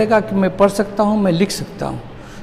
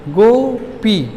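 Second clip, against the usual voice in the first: "Gopi" very slowly, in two separate beats; split into syllables; much slower than usual. Shown as a demonstration. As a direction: stretch both halves of "Gopi" for finger counting. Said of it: "उंगली पर बोलिए".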